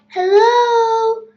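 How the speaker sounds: loud, high royal hello; cheery yell. A narrator's reading. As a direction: holler a drawn-out greeting in a royal voice.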